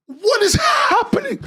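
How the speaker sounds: high shocked yell of disbelief; shocked shout. Real, not acted. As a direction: shriek it at the page. Shock at the page.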